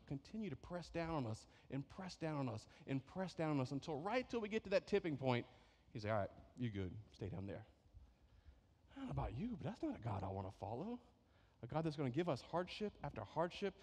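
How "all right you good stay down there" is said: lower, fading casual dismissal; mock dismissive God. God voiced leaving the believer crushed, dismissive and quieter. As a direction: shrug them off.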